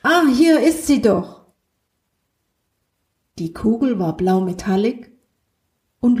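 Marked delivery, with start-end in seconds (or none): 0.0-1.4 higher, louder happy Ah, found it; light up as you find it; pleased discovery. Acted. Friend finds the ball in her spaceship, delighted.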